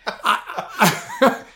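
usual speaking voice

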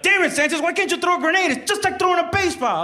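high-pitched mock berating; yelling drill sergeant. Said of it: "drill sergeants were yelling".

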